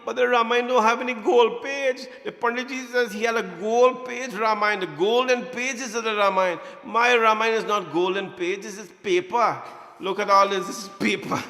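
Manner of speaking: put-on child wailing that the pages are only paper; upset child protesting. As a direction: protest to mom like a let-down little boy. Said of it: "I said".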